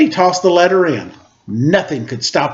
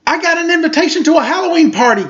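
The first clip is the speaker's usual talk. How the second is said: much higher, fast and thrilled; excited; 12 semitones higher, faster than usual. Acted. Charlie Brown telling Lucy about his invitation, high and thrilled. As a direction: burst out with the big news. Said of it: "Charlie Brown told her".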